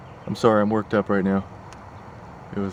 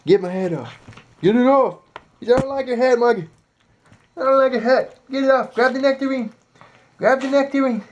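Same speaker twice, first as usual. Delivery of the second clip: very high squeaky pet voice; cartoonish critter voice; voicing the pet; 13 semitones higher than usual. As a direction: speak for the animal.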